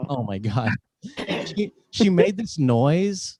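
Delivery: usual speaking voice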